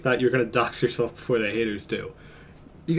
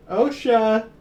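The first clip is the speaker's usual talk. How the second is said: high call out to the cat; high sing-song call for the pet; 9 semitones higher, 4 dB louder than usual. Real, not acted. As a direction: call the cat across the room. Calling the cat.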